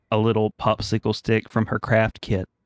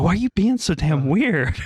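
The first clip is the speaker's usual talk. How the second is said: exasperated mock outcry, pitched way up; baffled, high-pitched comic complaint; 9 semitones higher than usual. Dad asking his licking daughter what is wrong, exasperated.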